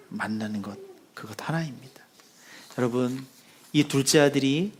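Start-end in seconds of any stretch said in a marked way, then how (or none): 0.1-2.0 soft unvoiced whisper to drive the point home; whispered emphasis. Real, not acted. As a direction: lean in and whisper the one thing. Preacher's hushed key point.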